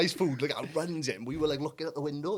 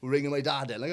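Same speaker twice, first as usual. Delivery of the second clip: ordinary talk, nothing marked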